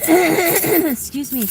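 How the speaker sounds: hoarse, slow after coughing; croaky from a cold. Real, not acted. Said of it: "I sound like I've got a cold".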